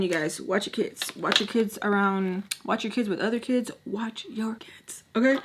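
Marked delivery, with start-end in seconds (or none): 3.8-5.0 slow hushed warning; whispered warning. Real, not acted. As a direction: lean in and whisper it. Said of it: "repeated warning to viewers".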